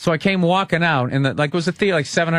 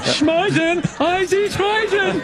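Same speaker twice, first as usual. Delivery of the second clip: mock Swedish gibberish; 13 semitones higher, much slower than usual.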